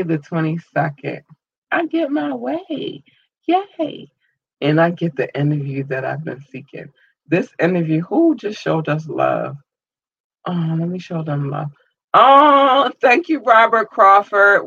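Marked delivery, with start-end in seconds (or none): 1.7-4.1 high, gleeful squeal of triumph; sing-song little victory cheer; excited squeal. Real, not acted. Celebrating getting the interview. 12.1-14.7 high, loud burst of delighted thanks; happy shout of gratitude; excited thanks. Real, not acted. Surprise shout-out to a supporter.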